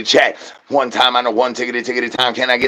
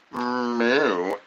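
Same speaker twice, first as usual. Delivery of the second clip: quiet mock cow moo; low soft moo; 4 semitones lower, 9 dB quieter than usual. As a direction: moo like a sleepy bull. Imitated bull noise.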